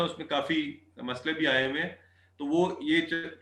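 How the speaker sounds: ordinary talk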